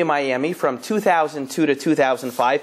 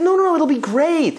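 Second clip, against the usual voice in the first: eager insistence; 11 semitones higher than usual. Retold or imitated. Rabbi insisting to Joey the Torah idea is great, eager and higher. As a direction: brush off his refusal with mock enthusiasm.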